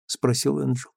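ordinary delivery, nothing marked

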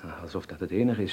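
ordinary delivery

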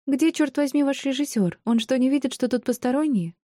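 high-pitched exasperated demand; irritated, rising temper; seething. A narrator's reading. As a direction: boil over in a high voice, without shouting. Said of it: "закипала Софи".

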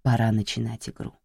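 ordinary delivery, nothing marked

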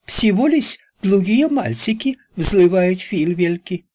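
high put-on Chinese accent; Chinese coolie voice. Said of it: "Ричи заговорил голосом китайского кули".

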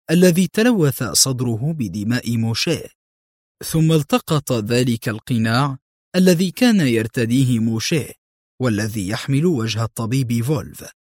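ordinary delivery, nothing marked

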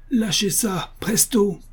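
high-pitched narrated yell; colonel yelling. A narrator's reading. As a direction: call out a sharp command without full force. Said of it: "hurla le colonel".

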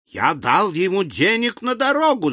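high-pitched outraged cry. A narrator's reading. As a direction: exclaim it high, frantic with indignation. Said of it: "закричал мистер Тапмен". (возмущённый крик)

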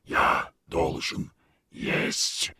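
slow whispered creature voice; gurgling alien voice. Acted. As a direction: breathe it like a hungry beast. Monster voice continues.

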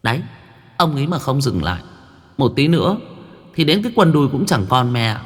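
put-on a little girl jeering at the shaman; cheeky young girl.

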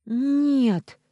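high, loud scream of refusal. A narrator's reading. Julia refuses the captor, high loud scream. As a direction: scream no with everything. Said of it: "закричала она".

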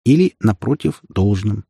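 ordinary delivery, nothing marked

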